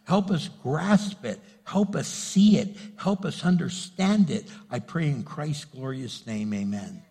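soft, low prayer trailing off to a hush; closing prayer. Acted. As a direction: pray quietly to close, voice sinking.

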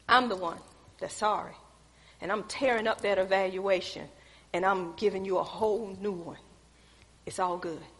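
low, soft retold reassurance; gentle conciliatory apology. Boss apologizing back to employee, low and soft. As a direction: drop low and quiet, making peace.

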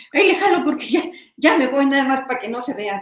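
high, loud, fast laughing mock of a lazy bed-pull; a laughing send-up of cutting corners; mock lazy self. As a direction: playfully act out rushing the job.

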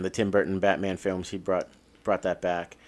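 ordinary talk

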